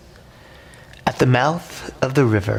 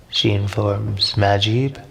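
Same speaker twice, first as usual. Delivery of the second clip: ordinary delivery, nothing marked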